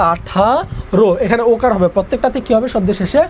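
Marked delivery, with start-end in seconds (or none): 0.1-1.1 "আঠারো" drawn out in syllables, aa-tha-ro; demonstrated pronunciation. Shown as a demonstration. Teacher answers own question on pronunciation, "আঠারো" slowly syllable by syllable. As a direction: stretch "আঠারো" and finish on a clear o. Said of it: "আঠারো উচ্চারণ কি হবে?".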